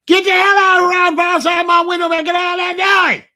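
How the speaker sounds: full-force high shout out a window; furious high-pitched holler. Retold or imitated. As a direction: imitate an old lady yelling them away. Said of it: "you hear her holler".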